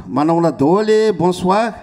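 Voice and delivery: excited greeting. Real, not acted. Singer greeting the concert crowd, excited call-out. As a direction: call the greeting out to the whole hall.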